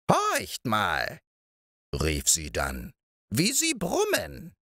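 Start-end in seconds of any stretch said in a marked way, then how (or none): 0.1-1.2 put-on high, slow crooning old witch voice; old moor witch. A narrator's reading. Old witch cooing over Balthasar's hen, high crooning voice. 3.3-4.5 put-on old moor witch. A narrator's reading. Old witch cooing over Balthasar's hen, high crooning voice.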